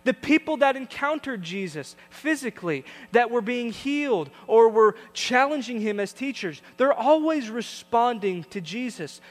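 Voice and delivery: preach. Acted. Pastor urging congregation to respond to Jesus, emphatic preaching. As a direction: drive the point home to the church.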